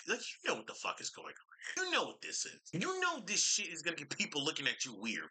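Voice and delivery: high-pitched exasperated rant; incredulous scolding. Real, not acted. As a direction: plead in high, baffled disbelief. Scolding the person in the video.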